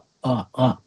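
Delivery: slow, soft, hesitant mock fumble; a dazed, halting imitation of the guest; imitating a dazed hesitation. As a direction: act out someone too foggy to answer. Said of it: "มึนๆนะ ดูช้าๆ".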